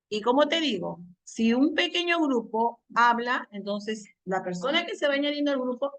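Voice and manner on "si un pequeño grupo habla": usual speaking voice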